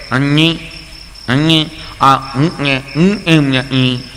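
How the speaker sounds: slow, unclear nasal mumble; nasal boy. Retold or imitated. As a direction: talk through the nose like a boy who can't shape sounds. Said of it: "એક એવો છોકરો ગૂંગણો".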